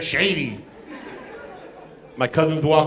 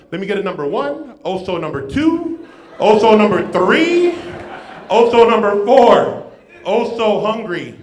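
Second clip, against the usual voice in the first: getting louder on each number; building order; 3 semitones higher, 7 dB louder than usual. Retold or imitated. Kid ordering with Oso joke.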